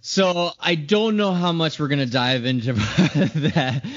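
laughing while starting to talk. Real, not acted. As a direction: laugh it off, amused. Laughs at Josh still mad.

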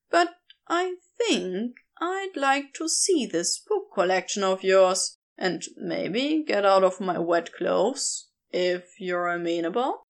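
Crowley, relaxed and a little suggestive; lightly voiced Crowley. Crowley voiced, lower than narration.

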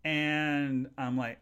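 usual speaking voice

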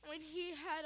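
normal talking voice

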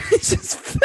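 squeaky laugh through words; words breaking into giggles. Real, not acted. Laughing while reading story.

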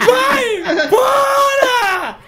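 very high, slow, laughing wail; mock tender yell. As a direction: wail it out half crying, half laughing. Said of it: "carinhosamente pra ele".

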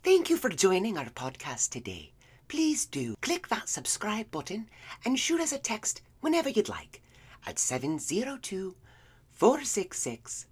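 lightly witchy, high sing-song intro; witchy announcer voice. Acted. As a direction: read the number like a sly old crone. Hammed-up intro in character.

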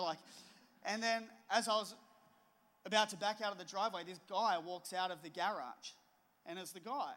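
ordinary delivery

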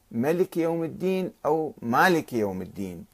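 ملك short, then مالك with long a. Shown as a demonstration. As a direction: contrast ملك and مالك, stretching the alif in مالك. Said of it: "في إثبات الألف وإسقاطها". (ملك بلا ألف ثم مالك بمد الألف)

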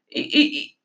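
tongue-tied stutter; embarrassed stammer. Acted. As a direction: stick on the first sound, flustered. Stammering, embarrassed before answering.

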